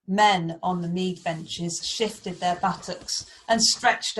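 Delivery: measured, emphatic storybook reading; story narration. A narrator's reading. Teacher reads Beowulf to Year Four, dramatic narration. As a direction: set the scene with weighty, deliberate phrasing.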